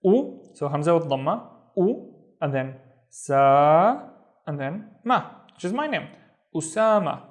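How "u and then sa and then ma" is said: u, sa, ma stretched out slowly one by one; slow syllable spelling. Shown as a demonstration. Teacher sounding out the name syllable by syllable. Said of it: "The first syllable is".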